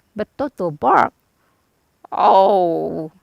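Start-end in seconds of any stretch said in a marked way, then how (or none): 2.1-3.1 yelp. A narrator's reading. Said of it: "yelped the lion".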